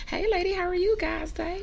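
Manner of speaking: high, sing-out customer hello; acted shop greeting, pitched well up; friendly greeting. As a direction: greet passers-by in a lifted, welcoming voice.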